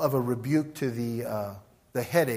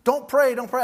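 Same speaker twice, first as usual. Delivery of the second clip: high, loud mock scream; acted shrieky outburst, pitched well up; retold screaming; 9 semitones higher, 6 dB louder than usual. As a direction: imitate her yelling in protest. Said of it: "she starts screaming".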